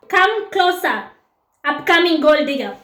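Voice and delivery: usual speaking voice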